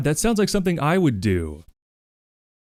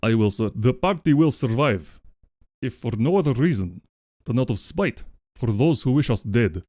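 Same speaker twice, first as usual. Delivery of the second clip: put-on Russian accent. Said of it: "let's do it in Russian".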